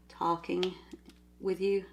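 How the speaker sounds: ordinary delivery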